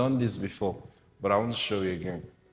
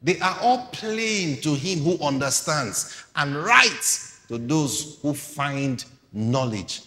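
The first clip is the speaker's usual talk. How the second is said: higher, louder scripture proclaiming; forceful sermon reading; preaching at full force; 5 semitones higher, 6 dB louder, slower than usual. Acted. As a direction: proclaim it to the crowd.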